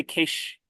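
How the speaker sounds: "cache" said as "kaysh", long A; one syllable, "cache" as KAYSH; imitated pronunciation. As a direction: say "cache" to rhyme with "aysh". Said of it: "I think you say cache".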